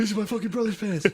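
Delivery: high-pitched pleading put-on voice; mock protesting kid. Playing a kid shaken down by police, mock protest.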